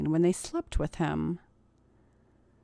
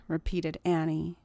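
plain reading voice

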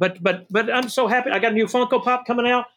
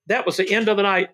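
ordinary talk, nothing marked

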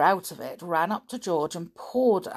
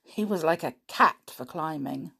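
ordinary delivery, nothing marked

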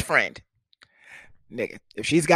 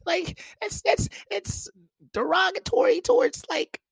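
high squeaky mock indignation; mock outraged back-and-forth. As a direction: play both sides of the spat. Arguing back, mocking the girl.

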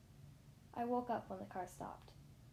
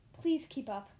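louder, pressing request to a driver; urgent plea; 3 semitones higher, 7 dB louder, slower than usual. A narrator's reading.

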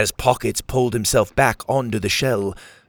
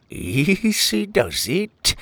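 slow, higher, drawn-out pirate voice; wary pirate captain; 8 semitones higher, much slower than usual. A narrator's reading. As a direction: placate him warily as a pirate. Pirate captain backs away warily.

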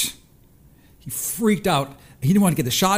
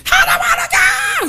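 put-on shrieked far higher and louder, a toddler in full meltdown; full tantrum scream; 7 dB louder than usual. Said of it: "He's just screaming it".

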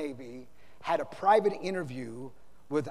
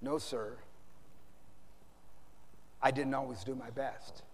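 low, soft confession; subdued admission; 4 semitones lower, 10 dB quieter than usual. Retold or imitated. Young officer confesses to the admiral, subdued and soft. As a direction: admit it quietly, voice dropped. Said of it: "He gulped and admitted".

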